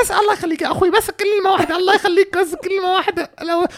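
high-pitched, whining sob-story imitation; mock begging woman. Woman begging for one word, mother is unwell, high mock pleading. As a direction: wail pitifully in a put-on high voice.

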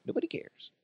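breathy whispered repeat. Acted. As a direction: whisper it like an aside.

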